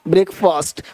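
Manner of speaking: ordinary delivery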